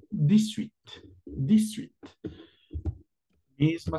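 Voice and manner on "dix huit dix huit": "dix-huit" said higher as dizwit; French dix-huit. Shown as a demonstration. Teacher modelling dix-huit. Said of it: "la pronunciación sería".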